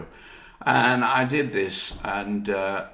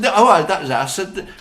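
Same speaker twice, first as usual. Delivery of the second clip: higher, louder, laughing alarm; mock dismay; 10 semitones higher, 8 dB louder than usual. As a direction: exclaim it as if all hope is lost. Sees crowds at the audition.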